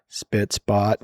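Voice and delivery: glum, low-pitched sign-off; depressing. Retold or imitated. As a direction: imitate a dispirited AI, voice dropped. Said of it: "lowered its voice and did the most depressing".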